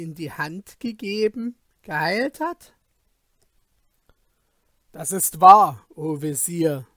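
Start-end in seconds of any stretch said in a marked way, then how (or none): 4.9-6.9 sharp, loud angry outburst from the king. A narrator's reading. King angrily agrees with his vizier, loud angry retort. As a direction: snap it back in anger. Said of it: "sagte der König zornig".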